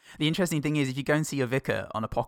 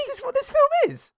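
loud, very high squeal; hammed-up falsetto outrage at the confusion; bewildered viewer; 7 dB louder than usual. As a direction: shriek it in mock disbelief. Acts bewildered viewer of the film.

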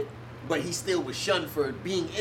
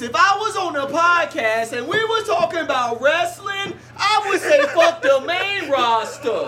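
Jay Moore impression; 11 semitones higher, 7 dB louder than usual. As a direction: do Jay Moore saying the catchphrase. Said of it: "Jay Moore would say it like this".

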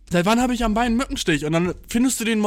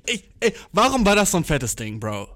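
ordinary talk

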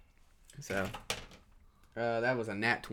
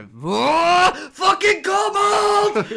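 high, drawn-out panicked scream; high slow scream of sudden fury; raging yell; 11 dB louder, much slower than usual. Acted. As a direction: shriek it as the rage hits. Character flips out at kobolds.

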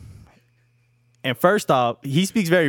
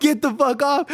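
high mock panic; retold urgent warning; 12 semitones higher than usual. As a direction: imitate friends calling him off. Acting out friends yelling at him.

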